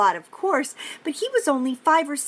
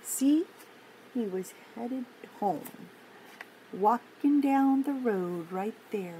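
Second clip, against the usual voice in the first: gentle storybook read-aloud; reading to children; 2 semitones lower than usual. Acted. Teacher reading a picture book to kids, gentle storytime voice. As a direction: read it like a storytime for little ones.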